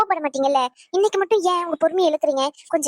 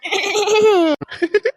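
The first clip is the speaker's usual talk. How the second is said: laugh; 4 semitones higher, 3 dB louder than usual. Acted. Wife laughing as scene opens.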